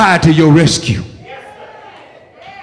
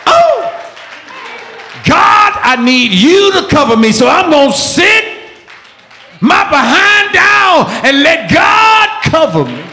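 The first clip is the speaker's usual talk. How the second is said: high, urgent preacher's cry; full-force preaching; 10 semitones higher than usual. Acted. Preacher urging congregation to seek rescue, full-force preaching.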